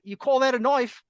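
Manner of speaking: laughing Aussie tough-guy voice; Crocodile Dundee impression. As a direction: ham up an Australian bushman boasting. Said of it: "He's like".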